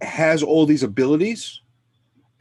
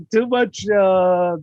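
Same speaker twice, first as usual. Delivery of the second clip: laughing; 4 semitones higher, 5 dB louder than usual. Real, not acted. Laughing while teasing friend.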